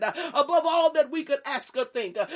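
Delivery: usual speaking voice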